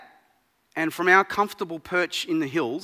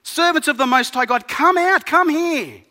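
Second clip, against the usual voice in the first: high, loud royal summons; shouting king; 10 semitones higher, 10 dB louder than usual. Acted. Nebuchadnezzar shouting into the blazing furnace, loud and high. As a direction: call them out of the furnace at full voice. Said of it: "and shouted".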